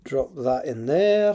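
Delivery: put-on slow Scottish accent; drawled Scottish accent. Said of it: "I don't know why I'm doing Scottish accent".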